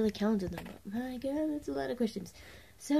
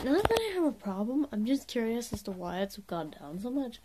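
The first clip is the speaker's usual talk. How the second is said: ordinary talk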